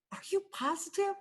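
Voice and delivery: high, shaky question; ask, still unsure and scared; doubtful old lady. Acted. Doubtful shaken librarian.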